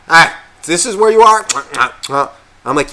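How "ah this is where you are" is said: high, loud mock-surprised drunk friend; drunk buddy. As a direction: ham up the oblivious drunk pal finding you. Drunk friend voiced in story.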